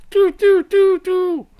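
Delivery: very high, loud alien shriek. Pretend. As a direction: squeal like an alien transmission. Alien beaming bit.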